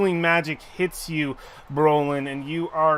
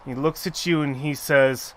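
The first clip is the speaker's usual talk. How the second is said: ordinary talk, nothing marked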